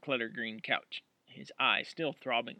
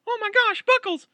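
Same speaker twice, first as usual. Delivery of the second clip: alarmed shriek; 12 dB louder than usual. Acted. Roommate sees the hurt dog.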